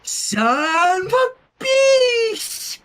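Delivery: put-on high, very slow toddler chant. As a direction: draw the curse out gleefully like a child. Toddler refuses, repeats curse.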